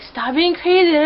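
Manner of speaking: high-pitched imitation of naggers; exaggerated mock telling-off; mock scolding. Voices everybody scolding the listener.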